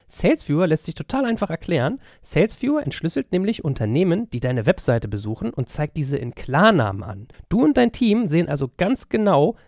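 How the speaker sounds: energetic podcast ad voice; ad read. Acted. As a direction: sell it like a radio spot. Host reads sponsor ad.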